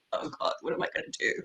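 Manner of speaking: a frantic whispered aside to herself; quick breathless whisper of mock panic; panicked whisper. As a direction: hush it out fast, under the breath, panicking. Panicked inner thought, retold.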